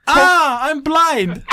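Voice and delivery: laughing mock cry of blindness. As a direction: ham up being blinded, laughing.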